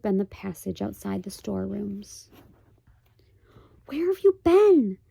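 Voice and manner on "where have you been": high, louder anxious question; anxious boy. Acted. The boy asks the frightened borrowers, high and anxious. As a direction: ask it worried and urgent.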